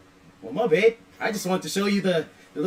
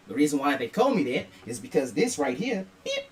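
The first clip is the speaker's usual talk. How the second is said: ordinary talk, nothing marked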